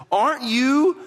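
high, slow taunting question; insulting. Acted. Criminal insulting the Messiah beside him, tauntingly. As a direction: jeer it as a drawn-out question, rising at the end. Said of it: "he doesn't just yell insults".

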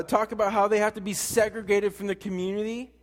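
usual speaking voice

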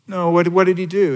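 normal talking voice

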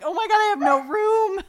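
high, loud exaggerated panic; mock panic. Craft lover retelling her storage panic, high and loud. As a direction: throw up your hands in panic. Retold own panic over supplies.

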